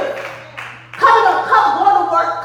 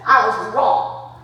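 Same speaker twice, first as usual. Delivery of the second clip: usual speaking voice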